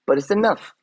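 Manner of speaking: high, strained "enough"; pleading that it's enough; voice going high. Real, not acted. Emotional end of rant.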